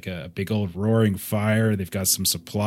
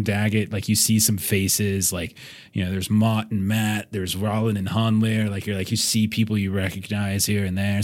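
usual speaking voice

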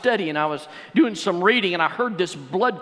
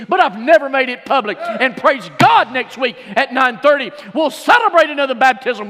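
louder, higher, driving preacher's voice at the climax; full-force preaching; 4 semitones higher, 4 dB louder than usual. Acted. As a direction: preach it out to the whole church, building to a shout. Preaching climax about the altar call.

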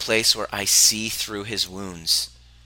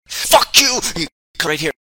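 high, loud imitated shout at a stranger; angry yell; 8 semitones higher, 9 dB louder than usual. Addict on the street yelling at him, imitated angry shout. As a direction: bark it out like a raging addict. Homeless man confronts speaker.

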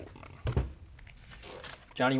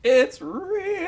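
excited; 9 dB louder than usual. Real, not acted. Excited outburst at game reveal.

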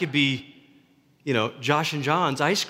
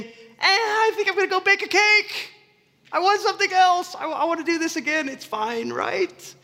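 much higher, whiny mock voice; whiny excuse voice; 13 semitones higher than usual. Pastor mocking believers answering the Holy Spirit, high whiny voice. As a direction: whine the excuse like a spoiled child.

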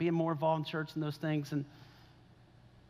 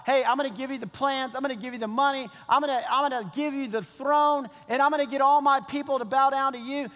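raised, emphatic pulpit delivery; impassioned preaching; 6 semitones higher, 3 dB louder than usual. Acted. Preacher voicing a promise of power, impassioned. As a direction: preach it with rising fervor.

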